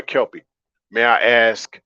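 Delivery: normal talking voice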